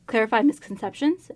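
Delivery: ordinary talk, nothing marked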